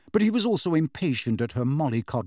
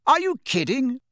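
high, disbelieving question; incredulous; 12 semitones higher, 3 dB louder than usual. A narrator's reading. Bill answering Harry's doubt, incredulous. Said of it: "said Bill incredulously".

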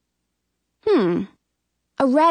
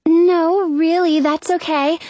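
put-on higher girl's voice; polite insistent girl; 7 semitones higher, 3 dB louder than usual. A narrator's reading. Girl refusing the lady's gift, polite. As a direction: decline kindly but insistently.

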